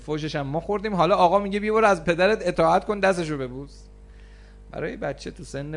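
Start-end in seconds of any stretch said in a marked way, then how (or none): 0.0-3.8 higher, louder aggrieved complaint; indignant teen. Retold or imitated. Retold angry teenager protesting an unfair slap. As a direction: voice the teen's outrage at the counselor. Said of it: "teen's angry complaint retold".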